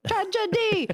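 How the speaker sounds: high Muppet squawk on [name]. Retold or imitated. Host mocks the [name], Muppet style squawk. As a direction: belt [name] like a Muppet singing. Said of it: "sounds like the Muppets".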